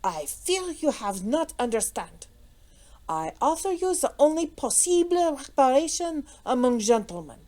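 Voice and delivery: put-on halting French accent, pressing a formal challenge; broken English, French accent. A narrator's reading. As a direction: insist earnestly in thick French-accented English. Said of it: "in still more broken English".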